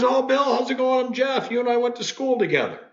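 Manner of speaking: high, quick friendly classmate voice; imitating old classmate Jeff. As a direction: play the bubbly old schoolmate, pitched high. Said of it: "He goes".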